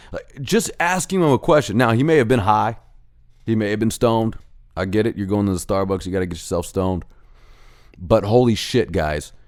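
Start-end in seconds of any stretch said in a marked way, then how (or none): none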